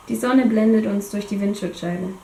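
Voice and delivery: ordinary delivery, nothing marked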